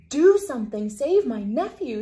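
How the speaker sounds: loud, high panicked shriek. A narrator's reading. Aunt shrieks at the admiral to save her nephew, loud panicked shriek. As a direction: scream for help in panic. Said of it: "shrieked Aunt Georgiana".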